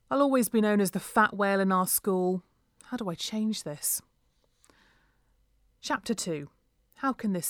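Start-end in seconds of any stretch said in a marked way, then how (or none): none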